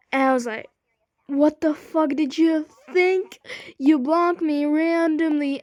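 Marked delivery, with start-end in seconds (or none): 1.3-5.6 slow, louder outraged retort; retold indignation. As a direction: throw it back indignantly, stretching the words. Retold quarrel over being blocked.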